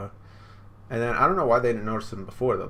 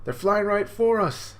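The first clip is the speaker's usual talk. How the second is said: raised high and louder in alarm; alarmed warning; 11 semitones higher, 5 dB louder than usual. Retold or imitated. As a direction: call out the danger. Luffy warns of attackers.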